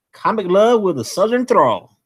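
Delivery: put-on playful Southern accent; Southern drawl. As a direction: say it with a touch of Southern twang. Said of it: "she wanted me to say".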